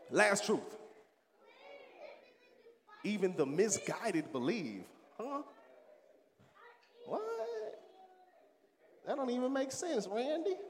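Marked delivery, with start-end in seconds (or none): 5.2-10.6 incredulous. Pretend. Mock disbelief at a quote.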